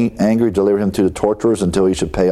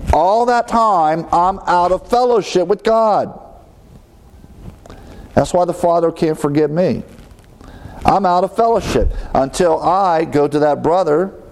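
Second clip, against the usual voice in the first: higher, slowed, intense insistence; rising preaching fervor; 8 semitones higher than usual. Real, not acted. Preacher pressing the point to his congregation, rising fervor. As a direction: lift it into earnest urgency.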